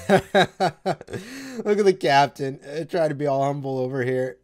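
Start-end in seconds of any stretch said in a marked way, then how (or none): 0.1-2.6 laughing. Real, not acted. Laughing at the captain onscreen.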